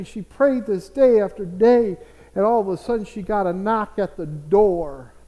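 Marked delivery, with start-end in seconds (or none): none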